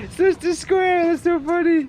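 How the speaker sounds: high amused delight. Real, not acted. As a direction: laugh it off brightly. Amused at the gadget.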